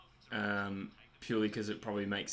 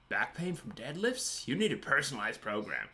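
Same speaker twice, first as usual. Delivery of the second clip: mock ad pitch; 3 semitones higher than usual. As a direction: parody an infomercial selling a fix. Mock advert pitch for friend's academy.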